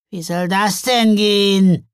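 slow, loud, doubting question; suspicious. A narrator's reading. Troll questioning Father Frost's idea, suspicious. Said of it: "fragte Löffellecker misstrauisch".

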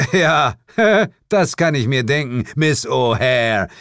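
Miss O'Hare langsam und lachend betont; Betonung auf Miss O'Hare. Acted. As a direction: zieh den Namen Miss O'Hare spöttisch in die Länge. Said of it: "Ich betonte ihren Namen". (Betonung: Miss O'Hare langsam und spöttisch)

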